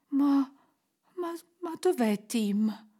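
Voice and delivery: hesitant, faltering start; fearful question. A narrator's reading. Scrooge asks the spirit about Tim, fearful. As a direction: dread the answer as you ask. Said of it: "chiese Scrooge temendo la risposta".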